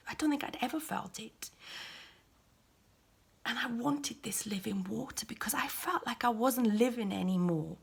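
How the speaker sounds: soft, breathy whisper; hushed awe. Acted. Woman recalls the peace she felt from him, whispering. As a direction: barely breathe it, overwhelmed.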